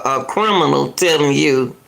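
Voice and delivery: ordinary talk, nothing marked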